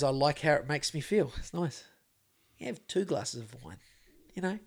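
lightly imitated teacher, soft and low; Eckhart Tolle. Quoting the teacher on drinking wine, hushed and low. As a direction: drop into a quiet, unhurried guru's musing. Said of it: "I, I, he goes".

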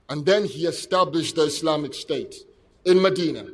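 ordinary delivery

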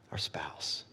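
breathed out with no voice, very soft; tender whispered close to loving our spouse. Real, not acted. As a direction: let the words fall to a bare whisper. Emotional point on loving spouse.

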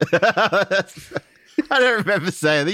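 high-pitched, laughing disbelief; high laughing protest. Real, not acted. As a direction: squeal it out between laughs.

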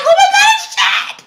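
very high, strained mock panic; panicked squeal. Cousins hearing the prowler's description, high panicked squeal. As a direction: squeal it in hushed-scream panic. Girls realise prowler is Chad.

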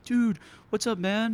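high excited greeting; hyped greeting. Retold or imitated. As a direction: greet him like an old friend. Greeting the fan at show.

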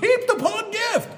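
joyful shout. Real, not acted. Preacher reaching the verse he loves most, shouting with joy. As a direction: burst out in ecstatic joy. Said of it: "This is what I really love".

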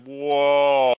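long, loud, drawn-out whoa; stoned amazement. Retold or imitated. Man half down a pot brownie, hammed-up stoned amazement.

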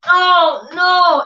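high, sad little "oh no"; daughter's dismay. Retold or imitated. Daughter seeing something is wrong, high dismayed "oh no". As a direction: imitate the child's disappointed voice. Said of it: "She was like".

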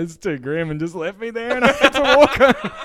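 high squealing laugh through the words; cracking up while telling it; laughing so hard the voice jumps high; laughing retelling. Retold or imitated. Retelling teachers abandoning him, laughing.

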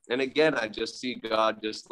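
usual speaking voice

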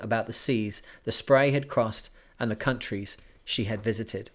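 steady, even audiobook reading; story narration. A narrator's reading. Podcaster reading a sailing memoir to listeners, measured narration. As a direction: read the voyage tale to listeners. Reads the book aloud.

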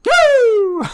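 piercing falling "woo"; loud high whoop. Pretend. Cohost reacting to the scare in the story, loud whoop.

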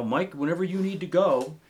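grateful client voice. Retold or imitated. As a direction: offer it kindly, like a thankful gravedigger. Grateful gravediggers telling guide to fish.

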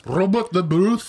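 Scottish accent. Shown as a demonstration. Co-host demonstrating Robert the Bruce, exaggerated Scottish accent. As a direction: roll it out in thick mock Scottish. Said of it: "say a sentence in a Scottish accent".

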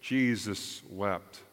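Jesus wept said very slowly; slow reverent. Real, not acted. Preacher quoting scripture slowly for weight. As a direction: let each word hang, reverently. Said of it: "reminds me forevermore of John".

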